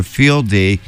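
normal talking voice

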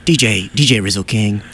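ordinary talk

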